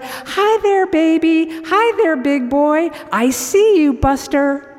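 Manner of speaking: high, loud cheerful call across the room. Acted. Old man calling out to the baby, high and loud. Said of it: "he calls out".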